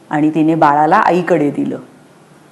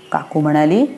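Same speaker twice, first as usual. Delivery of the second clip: ordinary delivery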